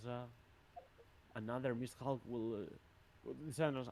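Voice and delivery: ordinary talk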